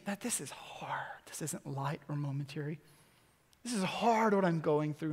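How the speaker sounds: hushed imitation of a weary listener; whispered complaint. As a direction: whisper it like a private hurt. Voicing a struggling listener's complaint.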